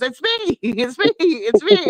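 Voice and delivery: the daughter giggling that it was her; daughter laughing. Retold or imitated. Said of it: "my daughter goes".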